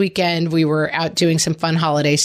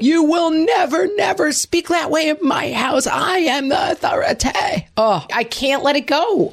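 mock furious parent; 9 semitones higher than usual. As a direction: bellow it like an outraged parent. Mock parent shouting at kid.

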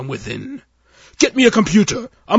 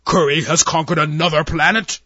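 loud furious alien admiral; roaring; 3 semitones higher, 7 dB louder than usual. Acted. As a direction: roar it out in outrage. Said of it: "the Admiral roared".

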